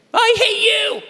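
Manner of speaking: high, loud upset yell; mock shout. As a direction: blurt it out like a hurt kid.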